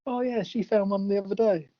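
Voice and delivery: a quick mock of Murtaugh's casual aside; imitating Murtaugh. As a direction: Murtaugh impression, tossed off for a laugh. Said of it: "Murtaugh just walks in and goes".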